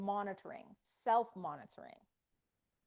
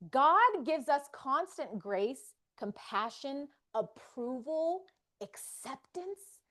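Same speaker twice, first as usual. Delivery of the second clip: slow, weighty list of gifts; 2 semitones higher, 3 dB louder, slower than usual. Real, not acted. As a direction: lay out each gift slowly.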